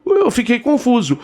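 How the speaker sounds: plain reading voice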